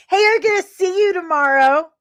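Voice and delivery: high, loud, eager promise; excited. Real, not acted. Telling a viewer he'll see them tomorrow, excited and loud. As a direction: call it out, thrilled to meet them.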